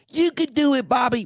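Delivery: high mocking cheer for a losing boxer; mock crowd cheer. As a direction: squeal it like a fan in the stands. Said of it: "cheering them on in the crowd".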